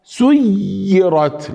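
سيرت with an overlong, forced double y; over-press the y in سيرت; excessive shadda. Shown as a demonstration. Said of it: "لا نشدد كثيرا". (سيرت بتشديد مبالغ فيه)

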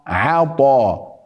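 عطاء slow, ع kept thin; عطاء بعين مرققة; light ain. Shown as a demonstration. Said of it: "قلنا ع".

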